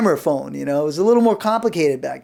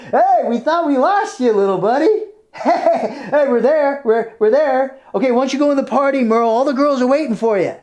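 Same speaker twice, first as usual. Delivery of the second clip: higher, cheerful coaxing buddy voice; coaxing a dazed friend; 4 semitones higher than usual. Retold or imitated. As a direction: coax a woozy friend to a party. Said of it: "We were like".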